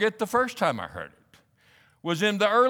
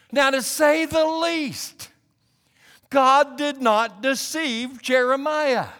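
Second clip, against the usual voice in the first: high, raised, urgent pulpit voice; impassioned preaching; 8 semitones higher, 5 dB louder than usual. Real, not acted. Preacher urging listeners to be honest with God, impassioned. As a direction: drive each question home to the congregation.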